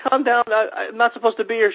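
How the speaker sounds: high-pitched hammed-up panic, pleading to calm down; mock panicked pleading. Guest retells calming a screaming hotel guest, high mock panic. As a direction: sell the mock terror, voice jumped way up. Both screaming at stranger in bed.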